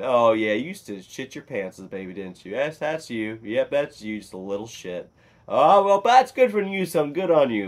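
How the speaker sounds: loud, teasing dad impression; dad's reaction. Dad recalling his son as a baby, mock-proud teasing. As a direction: swing up high and loud, imitating dad. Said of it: "my dad's reaction to it was".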